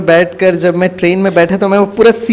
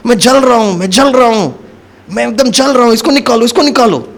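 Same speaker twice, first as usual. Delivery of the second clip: higher, frantic, imitating a man in agony; mock burning cries; 5 semitones higher than usual. Driver suddenly crying that he's burning, imitated panicked cries. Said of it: "वो इतना जोर से वो चीखने लगा".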